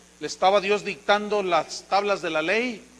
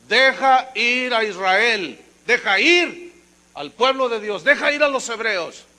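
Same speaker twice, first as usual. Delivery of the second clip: firm command; 3 semitones higher, 6 dB louder than usual. Acted. Moses commanding Pharaoh.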